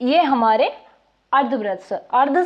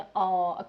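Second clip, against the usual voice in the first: pained aa; 6 semitones lower, 7 dB quieter than usual. Retold or imitated. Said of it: "किसी के चोट लग जाए तो ना".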